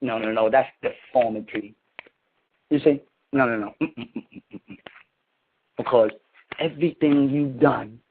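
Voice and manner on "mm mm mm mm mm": disapproving hum. Acted.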